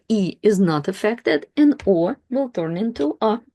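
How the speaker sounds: normal talking voice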